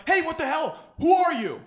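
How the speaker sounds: high, loud challenge to an intruder; angry confrontation. Acted. Man startled at his table yells at an intruder, angry. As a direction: shout it as an outraged stranger.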